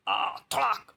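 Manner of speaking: high-pitched cry, then a crack; imitated cry and thwack. A narrator's reading. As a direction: act out a cry and sudden blow. Cry and blow read aloud.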